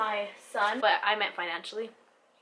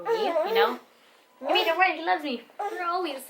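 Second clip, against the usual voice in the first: much higher, sweet and reassuring; 9 semitones higher than usual. Real, not acted. Mother assures viewers her son loves her, high doting coo.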